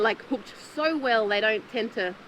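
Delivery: normal talking voice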